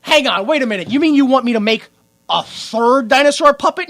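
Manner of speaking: loud, high disbelief; mock indignation. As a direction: protest like a fed-up puppet maker. Voicing an indignant puppet maker.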